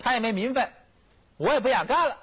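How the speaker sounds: high-pitched, indignant retort; acted worked-up emperor. Young emperor answers his chief minister, worked up. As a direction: play a young ruler all worked up. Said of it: "也，也兴奋了".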